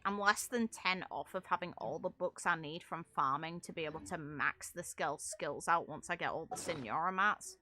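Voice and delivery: usual speaking voice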